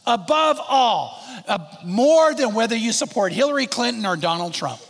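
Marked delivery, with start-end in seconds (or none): 0.0-1.2 ABOVE drawn out slow and loud; slow emphatic stress. Acted. Preacher to congregation, slow emphatic stress on Above. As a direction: lean hard and slow into Above all. Preacher drawing out key phrase.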